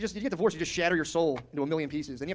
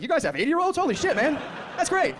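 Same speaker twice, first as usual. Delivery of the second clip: much higher and louder, astonished; amazed Ben Franklin; 12 semitones higher, 5 dB louder than usual. Retold or imitated. Ben Franklin hearing people live to eighty, high amazed. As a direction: gasp it out like unbelievable news.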